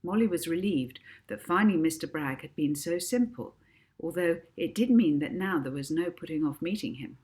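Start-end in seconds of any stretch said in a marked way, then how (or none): none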